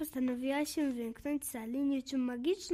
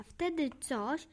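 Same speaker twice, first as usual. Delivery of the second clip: plain reading voice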